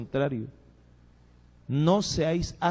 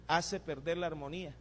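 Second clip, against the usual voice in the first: quiet repeat; 10 dB quieter than usual. Acted. Pastor repeats the warning to the church, softly. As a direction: let it sink in quietly.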